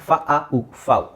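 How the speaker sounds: ಫ, ಆ, ಉ apart, then blended into ಫಾವ್; teacher blending ಫ ಆ ಉ into ಫಾವ್; sounded-out vowels. Shown as a demonstration. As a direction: spell it by sound, fa-aa-oo, ending ಫಾವ್ with silent x. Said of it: "ಎ ಯುನ ಹಾಗೆ pronounce".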